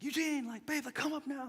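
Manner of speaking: high, urgent call upstairs; wife calling urgently. Retold or imitated. Wife urgently calling her husband upstairs, high-pitched. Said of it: "She was like".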